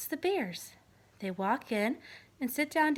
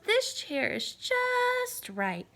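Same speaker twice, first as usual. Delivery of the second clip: put-on much higher, very slow little-girl voice; Goldilocks, delighted; 10 semitones higher, much slower than usual. Goldilocks in the little chair, high and very slow.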